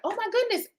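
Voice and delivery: high, startled gasp of dismay; sudden high-pitched dismay; dismayed oh my goodness. Retold or imitated. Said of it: "you're like".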